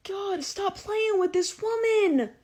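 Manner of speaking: high, loud, drawn-out plea; exaggerated high-pitched exasperated appeal; mock exasperation. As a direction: cry it out slowly, hammed-up and fed up. Mock exasperation after the outburst.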